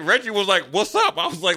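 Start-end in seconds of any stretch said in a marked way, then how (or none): 0.7-1.2 pitched way up, inviting the fight; daring retort. Retold or imitated. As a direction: throw it back like a challenge. Coach answering the challenge.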